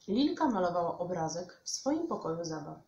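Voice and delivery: usual speaking voice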